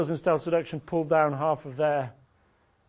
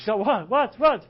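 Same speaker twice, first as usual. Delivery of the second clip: higher, louder acted startled question; mock alarm; 7 semitones higher, 7 dB louder than usual. Girl reacts as he pretends to leave, mock alarm.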